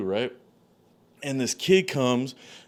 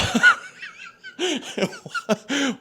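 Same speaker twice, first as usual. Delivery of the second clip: high, helpless laughing fit; cracking-up laugh. Real, not acted. Storyteller reaching his own punchline, helpless high laughter. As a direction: break down laughing mid-sentence.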